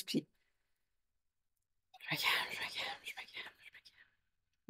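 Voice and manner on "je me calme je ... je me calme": hushed chant to settle yourself; barely audible repeated whisper, slowing at the end; whispered self-calming. Retold or imitated. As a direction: whisper it like a mantra, fading away. Acts out calming herself down.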